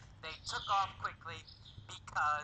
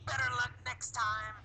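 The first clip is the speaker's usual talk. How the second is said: high, quick taunting call; mild mock yell; 6 semitones higher, faster than usual. Alan taunts Tom while zooming past, mild mock yell. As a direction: call it out as a light, acted yell. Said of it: "He yelled".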